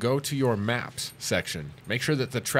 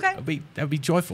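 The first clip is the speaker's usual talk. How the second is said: mock-English accent, pitched a bit higher; put-on English accent; playful English accent; 4 semitones higher than usual. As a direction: play it as a jolly English person. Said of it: "an English accent 'cause it's just bloody fun".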